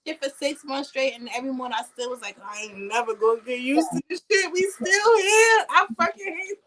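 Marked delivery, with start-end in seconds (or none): 2.4-6.6 acted-out complaint rising to a high, loud wail; hammed-up miserable protest; exasperated wail. Acts out her morning complaint.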